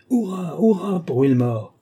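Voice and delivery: cheering for the winner from the crowd. A narrator's reading. Spectators cheering on the racer, lightly voiced cheer. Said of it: "crient les uns".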